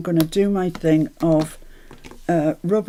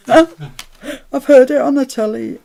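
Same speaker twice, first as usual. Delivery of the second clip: slow, raised voice breaking with laughter; laughing; 6 semitones higher, 9 dB louder, slower than usual. Real, not acted. Answering a viewer's question about the accent, laughing. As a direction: laugh through the words, louder and higher.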